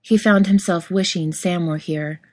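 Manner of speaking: ordinary delivery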